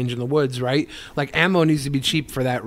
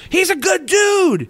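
mock high praise; 13 semitones higher, slower than usual. Mocking praise of wrestler.

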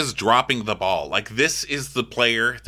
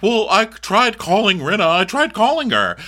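higher, louder defensive retelling; mock protest; 9 semitones higher, 4 dB louder than usual. Reality star protests that she tried calling, mock protest. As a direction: act out an indignant protest.

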